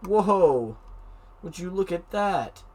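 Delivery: slow, high amazed wonder. Real, not acted. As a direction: marvel at it, drawn out. Amazed at symmetrical eyes.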